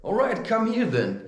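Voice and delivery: high, taunting call to come over; retold dare. Daring the guy who got up, high taunting call. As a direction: invite the fight, pitch up. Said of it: "I was like".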